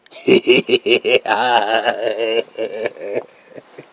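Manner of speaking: loud high cackle verging on a cry; long, loud manic cackle; hysterical laugh. Acted. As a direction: laugh until it cracks. Villain laugh.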